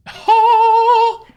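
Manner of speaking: high, loud, drawn-out cry; plain exclamation without accent. Pretend. Streamer reacts to the credits, high loud cry without the accent. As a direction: let out a long, high whoop. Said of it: "I can't do that in action".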